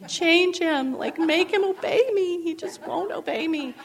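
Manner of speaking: high, loud begging, half laughing it off; pleading to the Lord over a defiant toddler; retold tearful plea. Mother recalling her prayer, hammed-up desperate plea. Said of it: "crying on the floor".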